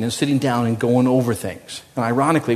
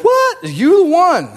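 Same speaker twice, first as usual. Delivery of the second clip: shocked disbelief; 5 dB louder, slower than usual. Retold or imitated. David's shocked reaction.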